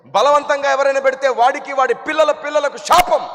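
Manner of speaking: high, loud insistent explanation; the elder lectures loudly on vows; loud lecturing. Retold or imitated. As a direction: lay down the rules forcefully.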